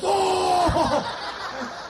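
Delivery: high laughing yelp; jolted cry as if zapped; mock shocked yelp. Brother shocked by car battery.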